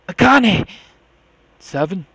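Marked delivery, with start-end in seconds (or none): 0.1-0.7 sudden loud high cry of her name; shout. Acted. Junpei hearing Akane's voice, shouting her name in shock.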